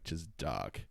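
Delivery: normal talking voice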